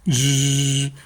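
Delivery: playful drill noise between questions; dentist drill buzz. Retold or imitated. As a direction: mimic the whirr of a dentist's drill. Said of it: "lo trato de hacer como lo hacía el hermano".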